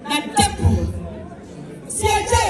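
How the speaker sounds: squeaky character voice. Acted. Character voice in tale.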